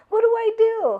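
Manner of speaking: put-on high, loud, laughing kid question; confused child. Little boy asking mom for help, confused. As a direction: squeak it out, lost. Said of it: "And he's like".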